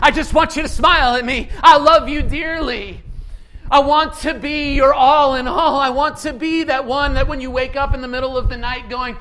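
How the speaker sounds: loud, high, fervent promise; loving preaching voice. Acted. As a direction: speak Jesus's love to the crowd. Voicing Jesus in sermon.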